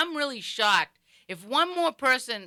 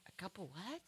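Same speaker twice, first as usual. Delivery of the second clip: hushed, puzzled question rising at the end; baffled aside; 23 dB quieter than usual. Retold or imitated. Bartender unable to follow John's order, hushed bafflement. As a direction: ask it very softly, lost.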